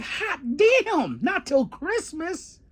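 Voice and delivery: very high, drawn-out disbelief; mock shock. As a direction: squeal it in exaggerated astonishment.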